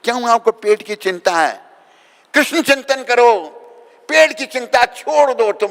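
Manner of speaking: loud, high preaching shout; forceful exhortation. Real, not acted. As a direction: exhort the crowd at full force.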